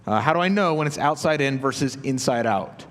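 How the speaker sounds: ordinary talk, nothing marked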